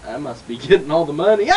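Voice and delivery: ordinary talk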